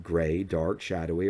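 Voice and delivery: plain reading voice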